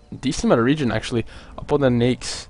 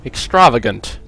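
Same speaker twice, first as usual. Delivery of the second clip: put-on posh accent; 4 dB louder than usual. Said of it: "say that with, like, a posh accent".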